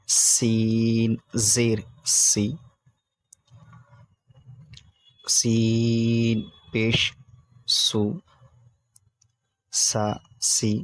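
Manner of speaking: سین زیر سی with a long hissing whistled s; drawn-out sibilant hiss on سو; whistling seen. Shown as a demonstration. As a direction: stretch the s of سین into a sharp whistle. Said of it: "سیٹی والی آواز نکالنا ہے".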